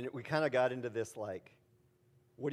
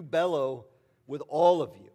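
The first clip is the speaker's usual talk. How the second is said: louder showing how to bellow; bellowing demo; 9 dB louder than usual. Shown as a demonstration. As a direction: put your whole chest into it. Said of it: "deep breath in to bellow".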